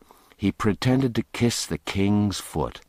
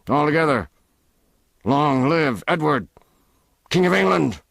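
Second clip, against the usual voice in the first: higher, louder rallying call; leading a cheer; 8 semitones higher, 5 dB louder than usual. Acted. Ruffler leads the cheer.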